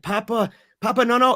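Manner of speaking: high, loud imitation of a frightened child; retold panic. Daughter feels the storm coming, panicked cries. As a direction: cry out rising in alarm, as the daughter.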